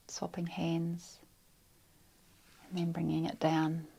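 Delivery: quiet phrases with long, restful pauses; guided meditation. Real, not acted. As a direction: guide them gently inward, close to a whisper.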